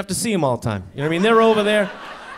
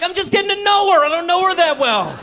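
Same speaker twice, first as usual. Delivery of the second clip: high, loud mock self-justification; acted-out loud defensiveness; defensive protest; 11 semitones higher, 4 dB louder than usual. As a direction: protest back in a raised, pleading pitch. Acted defensive answer to wife.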